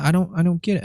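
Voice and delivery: high imitated woman's voice; buddy's wife. Wife not understanding the offense, high baffled voice. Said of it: "And she's like".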